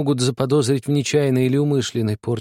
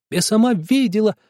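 shrill accusation; 10 semitones higher, 3 dB louder than usual. A narrator's reading. Nadezhda insists to father she saw it, shrill. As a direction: insist at the top of her voice.

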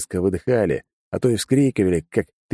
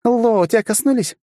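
high, loud call across the fight; urgent call; 4 dB louder than usual. Acted. Girl calling to Lo mid-fight, loud and urgent. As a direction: shout the question to a comrade.

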